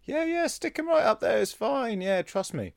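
high, airy reassurance; high mock confident doctor; breezy fake doctor. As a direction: toss it off lightly and high. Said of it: "they're like".

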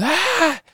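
very high mock shriek; imitated scream. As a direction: mimic a frightened shriek, held back in volume. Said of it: "she screams. She goes".

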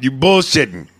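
ordinary talk, nothing marked

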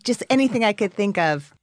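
ordinary talk, nothing marked

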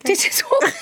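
high, laughing protest. Real, not acted. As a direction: laugh through the denial. Insists her drink is water.

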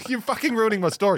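mock annoyed friend interrupting; mock Mason voice. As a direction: play Mason cutting in, annoyed. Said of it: "he goes".

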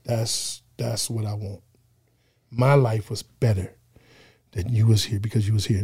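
hushed, sincere testimony with pauses; holding back emotion. Real, not acted. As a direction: speak tenderly and quietly, keeping emotion in check. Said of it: "I won't get emotional".

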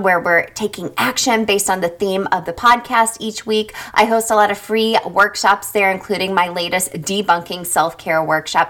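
ordinary talk

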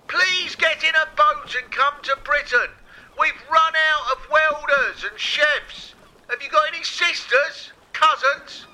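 high, loud bellow across the Channel; megaphone bellowing. Pretend. Priti Patel at Calais begging migrants through megaphone, bellowing. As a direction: yell it like a desperate megaphone appeal. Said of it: "take turns bellowing through his megaphone".

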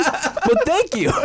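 high, slow laughing thanks; thanking him while cracking up. Real, not acted. Laughing at Mids' weird accent, slow.

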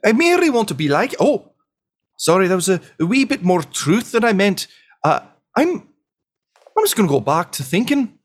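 high-pitched, apologetic squire; nervous squire Nibs. Acted. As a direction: back off nervously in a high, halting little voice.

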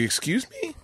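very high, soft affronted question; taken aback. Retold or imitated. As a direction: imitate a mother-in-law taken aback, voice shooting up. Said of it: "like, taken back".

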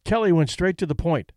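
ordinary delivery, nothing marked

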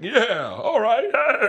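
slow, high and bright [name] bounce; [name] impression. Mocking a friend's [name], slow and high. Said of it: "I'm doing you doing [name]".

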